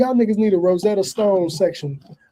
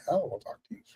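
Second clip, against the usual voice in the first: whisper; 4 semitones lower, 16 dB quieter, much faster than usual. Retold or imitated.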